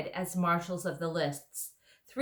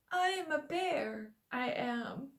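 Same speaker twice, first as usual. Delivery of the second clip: high, slow drowsy bear voice; thick sleepy bear; 7 semitones higher, slower than usual. Acted. Bear character voice.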